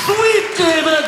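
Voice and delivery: high, loud, slow cheer; cheering shout. Real, not acted. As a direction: cheer his name like a crowd. Cheering name loudly.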